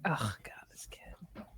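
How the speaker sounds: hushed, low acted grumble; muttered exasperation. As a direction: mutter it under your breath, half-whispered. Acted groggy reaction to child in bed.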